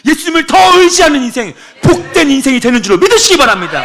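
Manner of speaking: loud, high climactic preaching; fiery sermon climax; preaching at full force. Acted. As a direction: lift the congregation with a rousing shout.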